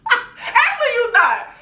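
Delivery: talking back to Tanya through laughter; laughing. Retold or imitated. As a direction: giggle the retort. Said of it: "I was just like".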